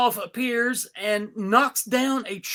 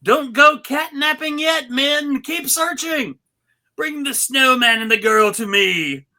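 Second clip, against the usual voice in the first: raised, loud villain cat giving orders; Majesty Meow's villain voice; 5 semitones higher, 6 dB louder than usual. Acted. Cat queen ordering her men, loud and commanding. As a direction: bark commands at the cat army. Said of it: "Majesty Meow says".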